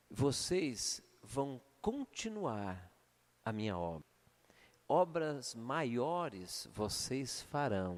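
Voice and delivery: slow, low, solemn voice of Jesus' words; slow, reverent quoting of Jesus; solemn scripture reading. Acted. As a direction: intone it like scripture.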